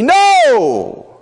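very high, loud shouted no; shouted protest. Acted. The carnal reaction to Jesus' death, a shouted no. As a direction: yell the refusal. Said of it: "our carnal fleshly reaction would be".

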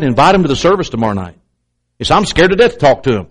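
ordinary talk, nothing marked

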